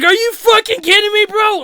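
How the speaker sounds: high, loud disbelieving shout; re-enacted ecstatic outburst; super excited. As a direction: yell in thrilled disbelief. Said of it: "I got super excited".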